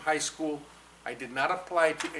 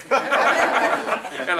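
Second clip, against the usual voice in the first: loud, slow, laughing; loud laughing relief; 4 semitones higher, 13 dB louder than usual. Real, not acted. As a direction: laugh through the words.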